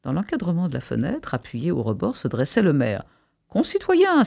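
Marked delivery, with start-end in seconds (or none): 3.5-4.2 high-pitched orator's call; crying out. A narrator's reading. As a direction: address the crowd from a window. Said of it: "s'écria-t-il". (exclamation haut perchée d'orateur)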